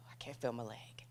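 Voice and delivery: breathless whisper, barely voiced; hushed whisper of shock. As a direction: let it slip out as a whisper. Recalling race pain.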